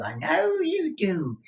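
high, slow knowing repeat; teasing old miser. Acted. Miser teasing Newman, high and slow. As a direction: tease him, pitch jumping up.

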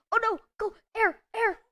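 high, loud panicked calling. Real, not acted. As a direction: panic for air. Running out of air underwater.

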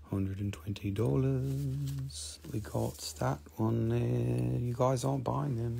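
very soft, slow and drawn out; hushed repeat. Real, not acted. Seller repeating the price to silent buyers, hushed repeat. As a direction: let the price trail along in a hush.

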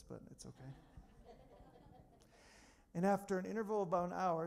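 usual speaking voice

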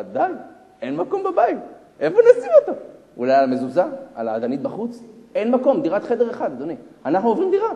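high, loud acted complaint; mock wifely protest, pushing up in pitch; exasperated wife. Wife telling husband there is no room, exasperated. Wife's first complaint about crowding.

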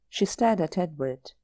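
ordinary delivery

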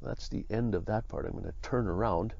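ordinary talk

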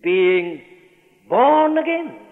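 preacher's ringing stress on "born"; emphatic scripture quote. Real, not acted. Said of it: "That's why I'm emphasizing verse".

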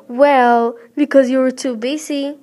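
loud, biting sarcasm; sarcastic. Acted. Girl sarcastically blaming her busy mother, loud. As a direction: throw it back at her sarcastically. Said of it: "said sarcastically".

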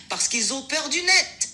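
laughing. Shown as a demonstration. Assistant tells a joke on request, laughing. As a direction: deliver the punchline through laughter. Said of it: "une phrase en riant".

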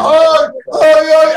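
high, loud imitated screams of "ay"; panicked screaming. Driver imitates friend waking in terror, panicked screaming. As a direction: mimic a terrified man's shrieks. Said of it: "a gritar".

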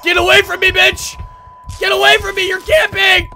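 loud, high yell to back off; panicked yelling. Real, not acted. Gamer to the granny camping him, panicked yelling. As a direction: shout it at an attacker closing in. Chased by the game's enemy.

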